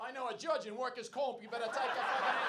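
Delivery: high, loud mock threat; blustering arrestee. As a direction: shout it as a guy resisting arrest. Acts out man threatening officer.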